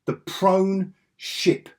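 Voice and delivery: slow, deliberate disbelief; slow emphasis. Real, not acted. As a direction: spell it out slowly.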